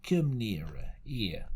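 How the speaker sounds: low, coaxing sailor voice; a lightly voiced sly pirate; sly old seaman. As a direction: beckon the boy with a seaman's wheedle. Stranger's voice in story.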